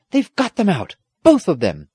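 high, loud announcement of relief; triumphant cry. A narrator's reading. Squire announcing both are saved, crying out. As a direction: shout the good news. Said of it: "Then the squire cried".

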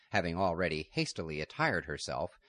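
usual speaking voice

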